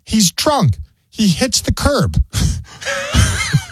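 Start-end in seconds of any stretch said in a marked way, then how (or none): 2.3-3.7 laughing. Real, not acted. Laughing at drunk man crash.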